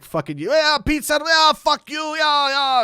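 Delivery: high, loud jeering fan; drunk heckler. Retold or imitated. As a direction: taunt the band like an obnoxious drunk. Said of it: "this guy's just like".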